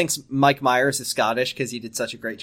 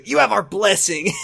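higher, more dramatic delivery; hammed-up blessing; 7 semitones higher than usual. George gives Ricky his blessing, hammed-up. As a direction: lift it into a mock-grand proclamation.

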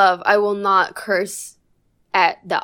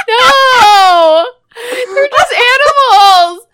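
high, loud anguished wail; anguished shout; 12 dB louder, much slower than usual. Acted. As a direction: wail it like heartbroken. Mock-anguished cry, shouted.

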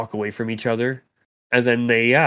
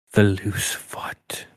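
slow, hushed whisper; secretive whisper; 3 semitones lower, 4 dB quieter, much slower than usual. Acted. Sori names the secret place to the party, secretive whisper. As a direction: whisper it slowly, like a secret name.

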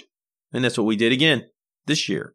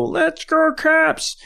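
very high-pitched imitation holler; mock arena bellow, pitched way up; bellowing fan yell; much slower than usual. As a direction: mimic the loudmouth fan's rallying cry. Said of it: "every time the dude would go".

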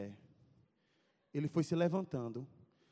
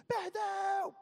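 high shouted gloat; bully's mocking yell; taunting shout; 5 dB louder than usual. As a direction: yell it in his face. Bully hitting his chest.

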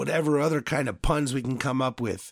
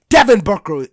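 loud, furious yell of the name; angry shout; 4 semitones higher, 12 dB louder than usual. Real, not acted. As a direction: shout the name in real rage. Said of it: "I'm mad".

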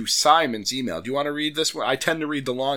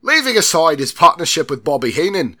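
loud, higher mock British accent; restored British accent; 5 semitones higher, 8 dB louder than usual. Host restarting the line after the slip, emphatic put-on British accent. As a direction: retake it firmly in a fake British accent. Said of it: "please forgive me for these accents".

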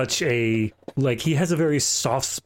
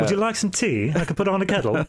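higher-pitched, lightly voiced courteous host; mock-polite villain; 8 semitones higher than usual. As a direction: offer it like an overly polite blackmailer. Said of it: "he's very polite".

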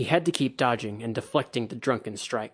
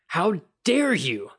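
slow, loud, higher outraged growl; 7 semitones higher, 5 dB louder, slower than usual. A narrator's reading. Bayushi answering Kikita's insult, slow loud growl. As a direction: let the outrage rumble out slowly. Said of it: "the Bayushi growled back".

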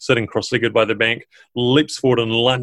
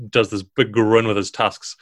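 usual speaking voice

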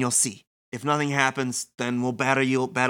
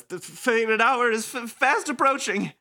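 higher, stammering nervous voice; nervous stutter; 7 semitones higher than usual. Acted. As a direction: stammer out of nerves. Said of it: "Very nervous Nagi says".

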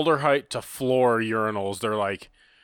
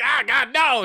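high, louder squeal of disgust; disgusted recoil; 13 semitones higher, 6 dB louder, slower than usual. Retold or imitated. Patron recoiling from the urinal cake. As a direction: recoil from the smell. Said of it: "He's like".

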